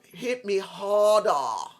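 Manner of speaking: slow, loud mock Boston accent quote. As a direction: ham up a Boston accent, stretching it out. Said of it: "You know, with a Boston accent".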